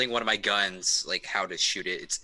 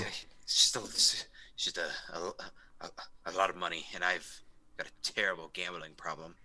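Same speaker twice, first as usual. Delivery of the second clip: nervous confession; 4 semitones lower, 7 dB quieter than usual. Acted. Sweating character confesses nervously.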